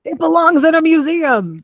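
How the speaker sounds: high, loud Indiana Jones shout; mock heroic movie line; hammed-up movie quote. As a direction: belt the famous line like the movie hero. Echoing Indiana Jones line louder.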